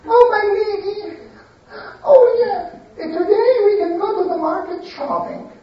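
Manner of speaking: high, eager to please; eager servant. Acted. Servant woman suggesting shopping to her lady, high and eager. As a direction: fawn over your mistress.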